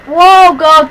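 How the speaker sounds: loud sudden scared yelp; startled cry. Real, not acted. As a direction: jolt and cry out. Monster appears in horror game.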